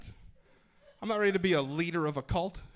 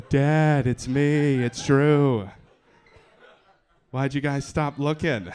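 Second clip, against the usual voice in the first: put-on lost kid calling out; 10 dB louder, much slower than usual. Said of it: "kid returning home".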